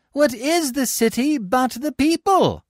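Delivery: high, slow rallying cry. A narrator's reading. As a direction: proclaim it to the crowd. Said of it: "Sicinius cries out".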